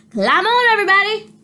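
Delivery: loud, high call to everyone; shouting. Acted. Duck calls the animals onto the tractor, loud shout. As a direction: call the whole barnyard aboard. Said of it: "shouted Duck".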